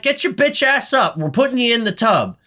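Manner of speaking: high-pitched, bossy mock order; imitated no-nonsense scolding; bossy command. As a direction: order a sulker up, acted out. Heroine bossing the sulking wizard.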